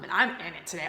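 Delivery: usual speaking voice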